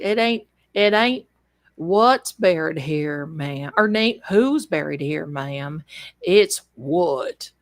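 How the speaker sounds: put-on low country accent of a small-town officer; Notso's accent. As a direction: drawl it low, imitating his country accent. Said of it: "in his accent".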